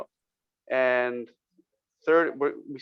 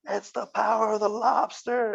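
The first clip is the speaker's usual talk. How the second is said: mock proclamation; 9 semitones higher than usual. Up on his chair after the home run, mock proclamation. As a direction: proclaim it like a rally leader, held back.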